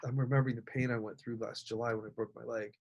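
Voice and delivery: quiet, choked-up memory; holding back tears while remembering; getting emotional. Real, not acted. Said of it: "I don't know why I'm getting emotional".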